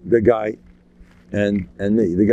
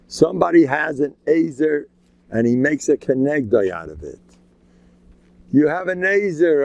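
normal talking voice

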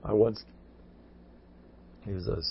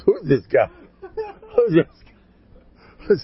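laughing disbelief; 10 semitones higher, 8 dB louder, much slower than usual. Retold or imitated. Astonished retelling with laughter.

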